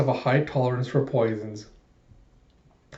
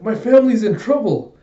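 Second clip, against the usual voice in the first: put-on high, loud little-girl plea; alarmed child; 9 semitones higher, 6 dB louder than usual. Small girl says her family's in trouble, high alarm. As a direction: insist urgently in a child's voice. Anya pleads with Frankie.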